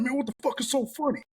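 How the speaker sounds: high-pitched exasperated demand; mock indignant. Young man confronting people laughing at him, mock indignant.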